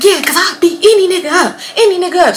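high, fired-up challenge; mock threatening. Sister challenges the homeboy who woke up, high mock threat. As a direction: act out a sister spoiling for a fight.

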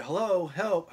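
high, loud mock cry for help; calling out. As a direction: shout it down the hallway like the old patient. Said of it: "I hear".

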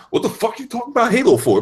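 ordinary talk

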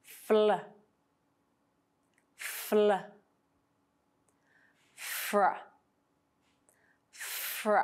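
"Fl" and "fr" said slowly, every consonant audible; blend demo. Shown as a demonstration. Teacher modelling blends for learners to notice each consonant. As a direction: let each consonant of "fl" and "fr" be heard. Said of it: "listen to the blends and try to notice every individual consonant".

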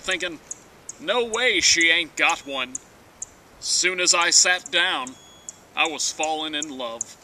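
ordinary talk, nothing marked

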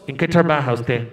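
low, deliberate official question; American officer. Retold or imitated. Officer repeats his question to the woman, low and deliberate. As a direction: drop low and ask it like a bored clerk. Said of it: "Después de preguntarle un montón".

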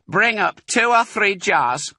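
high, loud call from upstairs; calling back. Acted. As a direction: answer down the stairs. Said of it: "the other voice called back".

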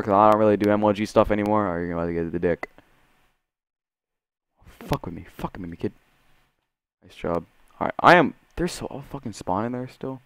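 ordinary talk

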